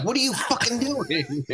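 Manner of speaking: high alarmed outburst; alarmed high shriek. Retold or imitated. Retold alarmed reaction.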